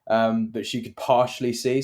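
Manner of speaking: ordinary talk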